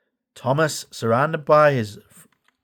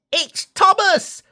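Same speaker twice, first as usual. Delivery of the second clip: very high, slow excited cry; Percy spots his friend's steam; 3 dB louder, slower than usual. A narrator's reading. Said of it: "Percy peeped".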